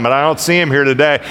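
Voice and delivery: usual speaking voice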